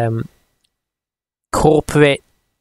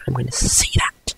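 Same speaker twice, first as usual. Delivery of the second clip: breathy whispered aside; 2 semitones lower, 6 dB quieter than usual. Real, not acted. Vlogger whispering a private vow to himself. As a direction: drop to a secret whisper.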